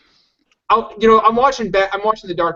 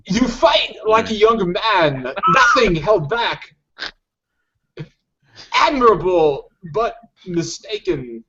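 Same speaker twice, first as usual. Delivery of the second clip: Walken as Bane; slower than usual. Retold or imitated. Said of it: "Christopher Walken, but like, if he was Bane".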